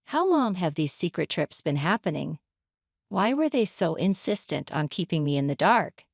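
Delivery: ordinary delivery, nothing marked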